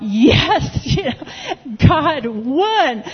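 jubilant, high, laughing retold cheer; hallelujah moment. Speaker watching a reunited couple from the back, jubilant. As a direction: relive a private triumph, laughing as pitch climbs. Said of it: "I was having a hallelujah moment".

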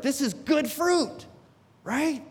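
high and insistent, demanding agreement; indignant protest. Retold or imitated. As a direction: argue the point to God, sure you're right. Arguing with God, indignant.

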